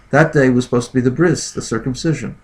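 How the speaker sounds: ordinary delivery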